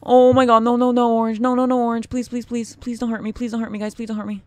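high, loud frantic begging; panicked pleading. Real, not acted. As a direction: beg desperately not to be hit. Cornered by other snakes.

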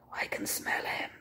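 whispering. Acted. Bertie in the dark doorway, whispering. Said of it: "he whispered".

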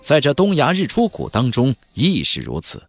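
plain reading voice

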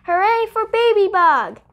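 high, loud cheer. Acted. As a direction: cheer together. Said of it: "everyone cried".

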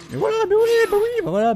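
high, loud sarcastic "of course"; mock agreement. Real, not acted. Player to the unfair game, sarcastically agreeing. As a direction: agree loudly and far too high, fed up.

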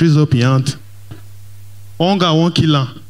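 normal talking voice

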